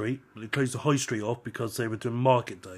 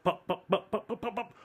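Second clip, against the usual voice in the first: rising, laughing mock pops; engine popping sounds; 9 semitones higher, 3 dB quieter than usual. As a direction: pop pop pop climbing higher through a laugh.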